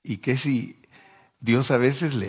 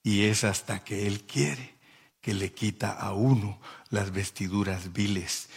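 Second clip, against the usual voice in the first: ordinary talk, nothing marked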